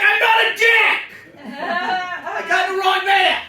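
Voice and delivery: loud high exasperated protest; indignant shout. Retold or imitated. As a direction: yell your innocence at the whole van. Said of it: "I'm like".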